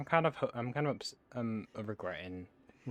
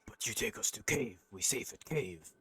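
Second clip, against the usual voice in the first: troll voice; 3 semitones higher, 4 dB louder than usual. Acted. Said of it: "Or, or is really set on the idea of eating the other troll".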